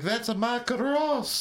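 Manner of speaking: put-on high, drawn-out Italian-accented wail. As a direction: stretch it out in a hammy Italian accent. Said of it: "she's got this very, uh, heavy Italiano accent".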